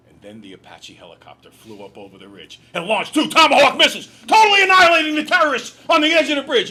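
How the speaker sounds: booming, high, mock-epic storytelling; over-the-top dramatic yell; hammed-up action reading. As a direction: shout it like an overblown action thriller. Psychiatrist fakes action-packed book reading.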